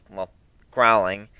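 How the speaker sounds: plain reading voice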